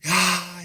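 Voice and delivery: high-pitched mock cry of yelling. Teacher imitating an upset coach, a mock yelled "ah". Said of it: "So he yells".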